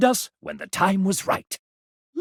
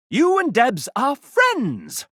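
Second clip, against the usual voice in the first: excited announcement; 7 semitones higher, slower than usual. Acted. Game announcer celebrating friendship.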